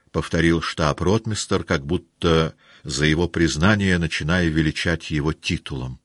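ordinary delivery

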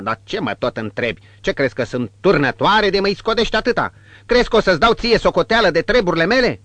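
high, loud, fast scolding; brutal voice. Acted. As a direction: tear into him rapidly. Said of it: "cu voce brutală".

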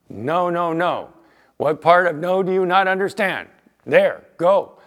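a hint of a no-nonsense dad laying down the law; stern dad. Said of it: "take that role".

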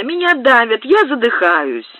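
a crushed lady complains to the captain, a touch of panic; lady gasping complaint. A narrator's reading.

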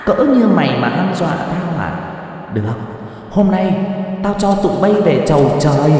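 menacing demon. Acted. She-demon taunts the geomancer before attacking. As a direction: sneer a death sentence at them.

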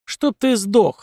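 злобно рявкнуть; резко бросить со злостью; рявкнула. A narrator's reading. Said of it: "рявкнула Атима". (злобный рявк)

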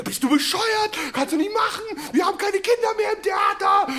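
high, shouted scolding. Retold or imitated. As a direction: yell at a colleague in horror. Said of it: "meine Mitspieler". (entsetzt schreiend)

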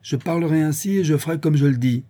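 father rebuffing his daughter, angrily; defiant anger. A narrator's reading. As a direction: answer the daughter with hard resolve. Father insists, rising.

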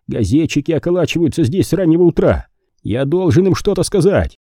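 urgent, worked-up plea; excited. Acted. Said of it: "возбуждённый голос Пейнтера". (возбуждённо, взволнованно)

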